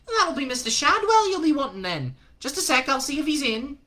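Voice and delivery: high put-on woman's voice, brisk and friendly; chirpy landlady calling everyone love; Madam Tracy. Madam Tracy answering the phone to Newton, chirpy and high. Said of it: "A woman answered".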